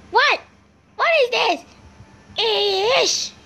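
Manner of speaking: high startled yelps. Real, not acted. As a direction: jump at the scare, voice shooting up. Startled by a jump scare.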